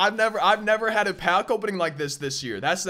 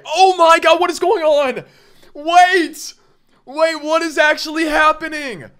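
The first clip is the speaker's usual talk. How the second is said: high, loud disbelieving yell; stunned, thrilled shouting; excited shout; 9 semitones higher, 9 dB louder than usual. Real, not acted. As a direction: shout in total disbelief at the pack. Big pull in pack.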